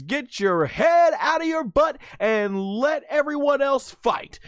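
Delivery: high-pitched imitation of a woman telling someone off; acted scolding. Bluma telling Vegeta to step aside, acted scolding.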